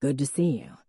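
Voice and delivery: a hint of a relaxed man's voice; Jack's voice. A narrator's reading. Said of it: "Jack said".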